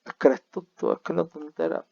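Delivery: playful mock rebuke; mock scolding. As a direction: tease the student, mock exasperation. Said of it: "hago la broma con".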